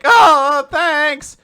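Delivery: high, loud, drawn-out reply; hammed-up awkward squeal; flustered mock thanks. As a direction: blurt it in an exaggerated high voice. Flustered man's reply, acted.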